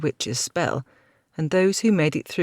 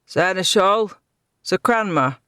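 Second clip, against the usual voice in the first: loud calling across the battle; shouting for comrades; 4 semitones higher, 4 dB louder than usual. A narrator's reading. Knight searching the battlefield, calling loudly. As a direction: call out to find them. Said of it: "he shouted".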